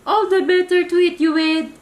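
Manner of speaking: high, loud roaring wolf voice; wolf unmasking himself to eat the girl; wolf shout. Acted. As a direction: bellow it as he pounces. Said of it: "shouted the wolf".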